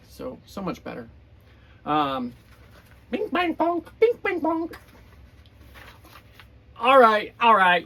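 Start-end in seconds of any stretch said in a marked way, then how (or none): 3.1-4.7 high chanted hammering noises; high bing bang bonk. Acted. Reading to kids, Grover hammers nails into the pages. 6.8-7.9 loud exasperation. Acted. Grover gives up.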